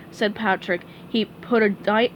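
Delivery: plain reading voice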